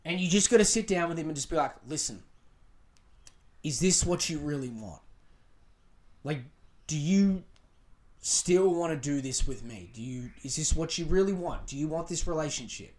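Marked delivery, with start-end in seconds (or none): 1.9-12.9 lower, measured questioning; calm and collected. Shown as a demonstration. Girlfriend sitting down with her boyfriend, calm serious question. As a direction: keep your cool and ask sincerely. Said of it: "you gotta keep your calm".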